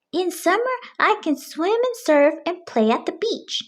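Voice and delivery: a hint of a kid missing summer; dreamy young Pete, lightly voiced; Pete wistful. A narrator's reading.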